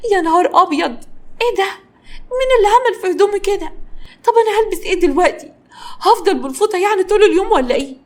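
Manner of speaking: high, loud shocked outrage; worked-up, high-voiced exasperation; exasperated fury. A narrator's reading. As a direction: fume in disbelief, pitched high. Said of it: "قعدت تتكلم بغيظ وحرق الدم".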